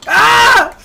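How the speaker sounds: loud, high startled yell; hammed-up startled scream; sudden scream. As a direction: shriek as if hit.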